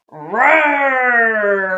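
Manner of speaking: long, loud, high roar; playful roar. Acted. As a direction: roar like a kid playing monster. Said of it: "roared Flea".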